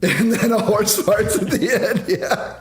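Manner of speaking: can't get it out for laughing; laughing at the horse fart joke. Real, not acted.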